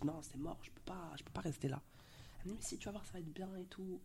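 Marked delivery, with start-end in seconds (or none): 0.0-1.8 retold tearful whisper. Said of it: "je pleure et tout". 2.6-4.0 higher, softer, a light coaxing mother voice; imitating her mother. Mother soothing her crying daughter in the car, lightly imitated higher and softer.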